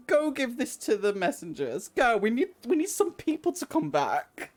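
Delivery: a hint of a high boy's voice; lightly voiced boy leader. Boy leader sends runner with message, lightly high. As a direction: send the runner off. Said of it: "he's like".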